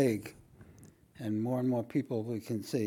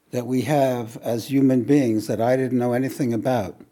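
preaching; 7 dB louder than usual. Acted. Preaching with rising force.

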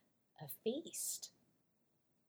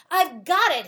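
bright, loud burst of a sudden idea; excited discovery; 5 semitones higher, 13 dB louder than usual. Acted. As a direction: blurt it out as the idea hits.

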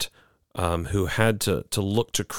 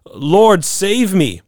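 loud, high plea for rescue; desperate cry; 10 semitones higher, 6 dB louder, much slower than usual. A narrator's reading. Peter sinking in the storm cries loudly to the Lord. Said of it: "and he cries out".

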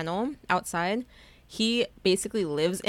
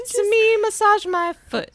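high, loud, slow mock command; hammed-up imperious spouse voice; demanding spouse; 14 semitones higher, 8 dB louder than usual. Hook ordering Smee like a married couple, demanding. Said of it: "they played it like they were a married couple".

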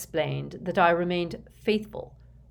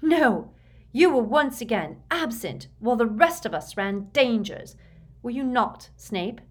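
high, raised-voice accusation; heated, emphatic challenge; furious; 7 semitones higher, 6 dB louder than usual. A narrator's reading. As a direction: attack with furious scorn. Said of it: "said Bellatrix furiously".

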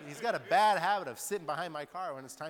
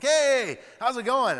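eager neighbor greeting; 12 semitones higher, 15 dB louder, slower than usual. Retold or imitated. Friendly neighbor calling across.

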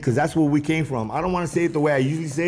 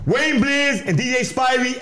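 normal talking voice

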